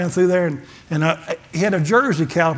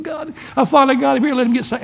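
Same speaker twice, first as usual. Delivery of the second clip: higher, tearful pleading to God; crying prayer; 7 semitones higher than usual. Retold or imitated. Preacher relives tearfully begging God at the altar call. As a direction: let the voice crack as you beg. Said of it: "I'm crying".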